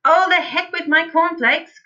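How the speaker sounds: high, loud and impatient; dismissive cry. A narrator's reading. Father leaping up from breakfast, crying out. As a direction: throw the remark away with gusto. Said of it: "cried Mister Cranky".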